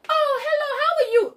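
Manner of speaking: high-pitched mock gushing hello; overly friendly greeting. People running up to her in town, overly friendly. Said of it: "they get all overly friendly".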